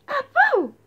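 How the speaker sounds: loud high sneeze; loud sneezing noise; sneeze sound. Acted. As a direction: blast out a sneeze. Fish sneezes.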